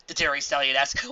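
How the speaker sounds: ordinary delivery, nothing marked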